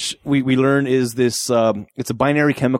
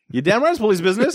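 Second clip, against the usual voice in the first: higher, fast sassy comeback; sassy retort; 9 semitones higher, faster than usual. Retold or imitated. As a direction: snap it back with attitude. Quoting Zeus's sarcastic retort.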